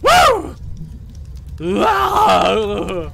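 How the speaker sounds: whoop, then wail it out; drunken whooping. Acted. Drunk girl carries on hollering, wild whooping.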